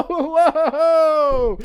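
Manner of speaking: high laugh breaking into a whoa; giddy laugh of delight; real joyful laughter. Real, not acted. Overjoyed at gift.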